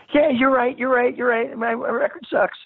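total geek. Retold or imitated. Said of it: "total geek".

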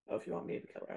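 quietly trying the line again. Shown as a demonstration. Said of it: "I don't know if I said that right".

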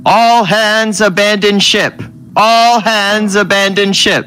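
high, slow intercom alarm call; shouting abandon ship. A narrator's reading. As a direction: blare it like a ship-wide evacuation order. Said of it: "the voice over the intercom".

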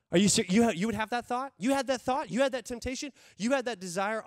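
loud, incredulous, rising questions; mock shocked accuser. Pastor voicing the enemy scandalized at temptation, loud mock disbelief. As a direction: play the enemy aghast at your thought. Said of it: "the enemy".

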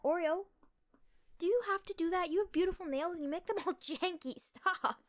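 high-pitched pleading with the dog; playful scolding. Real, not acted. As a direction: scold a pet, half laughing. Mock-scolds dog chewing her nails.

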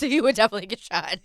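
laughing repeat of the warning. Real, not acted. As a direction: say it again with a laugh. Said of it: "Let that be known".

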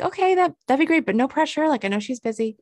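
high, bubbly eager voice; eager gushing. Retold or imitated. To the salon guy, eagerly gushing. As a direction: sound thrilled but polite. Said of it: "I'm like".

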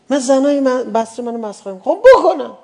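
high and loud, peaking into a shout; preacher crying out against mockery; defiant outburst. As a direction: fling it out defiantly.

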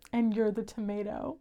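low, quiet deadpan retelling; deadpan serious. Retold or imitated. Retelling Dean's line to Cass, deadpan serious. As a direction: imitate him saying it in all seriousness. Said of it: "they're both so serious".

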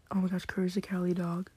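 ordinary talk

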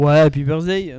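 booming into the mic; too loud. Real, not acted. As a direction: say it loud enough to clip. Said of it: "Le son sature un petit peu".

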